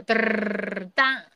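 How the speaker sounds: rolled-tongue drumroll, then a bang; mouth drumroll. Pretend. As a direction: announce it with a drumroll. Drumroll for ranking.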